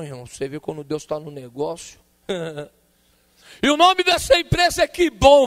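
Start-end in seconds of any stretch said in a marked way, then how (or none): 3.6-5.4 high, loud prophetic proclamation; missionary proclaiming the company name. Retold or imitated. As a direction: announce the revelation to the whole church.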